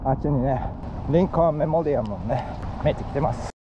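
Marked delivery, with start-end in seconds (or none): none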